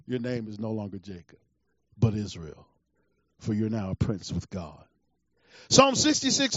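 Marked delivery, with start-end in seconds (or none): 0.1-4.8 hushed, very low pronouncement; low, quiet voice of God, solemnly acted; God speaking. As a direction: confer the name softly, deep down in the voice. Acting God renaming Jacob.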